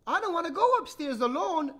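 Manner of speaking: put-on high, loud little-girl voice; complaining little sister. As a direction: complain like a small child. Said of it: "Little Sister complained".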